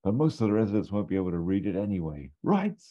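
quick high muttered backpedal; imitating Basil. Hotelier talking himself round, fast and high. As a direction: rush it, then squeak the rights. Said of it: "he just goes".

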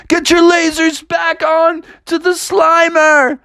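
high, slow shouted order; drawn-out urgent yell; Venkman. Acted. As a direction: holler commands mid-battle. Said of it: "Venkman was like".

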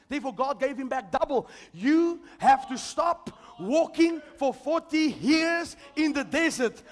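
loud, high, slowing on the key lines; full-force preaching. Acted. Preacher urging the congregation to stop speaking defeat, full force.

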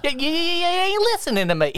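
high twangy mock voice, goofy and earnest; Gomer Pyle impression. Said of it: "just like Gomer Pyle".

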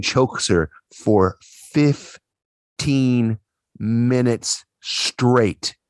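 drawn-out, slow for emphasis. Real, not acted. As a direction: stretch fifteen minutes out slowly. Stretched out for emphasis.

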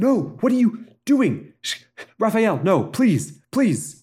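high, desperate cries of no and please; pleading yell. Acted. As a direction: beg him to stop, yelling high. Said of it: "gets up on his feet and yells".

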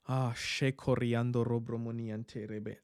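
quiet tongues murmur. Real, not acted. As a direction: drop to a low private murmur in tongues.

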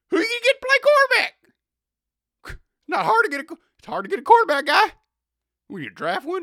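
radio host raging about quarterback money; amped-up radio rant. Retold or imitated. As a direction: rant at the team like a furious sports host. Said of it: "he gets amped up".

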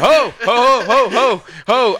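high loud ho ho calls; wordless yelling. Retold or imitated. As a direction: shout ho over and over, pitched far up. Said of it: "He's just like".

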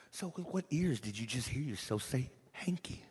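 whispered, searching question; hushed whisper. Real, not acted. Preacher asking the church in a hushed whisper. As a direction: draw them in with a whisper.

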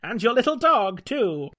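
slow, high, loud villain sneer; gloating evil witch taunt; evil cleric. Acted. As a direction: cackle it out like a wicked witch. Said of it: "The evil cleric laughs".

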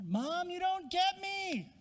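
put-on high, slow whining teen; whiny teenager. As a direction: drag it out like a sulky thirteen-year-old. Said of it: "reminds you of the teenager".

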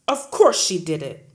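loud booming; boomed. Acted. Headmistress booming at the teacher, loud. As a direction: boom it out. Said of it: "Miss Trunchbull boomed".

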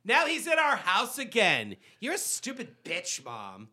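mocking bratty daughter. Mock daughters scolding dad's return.

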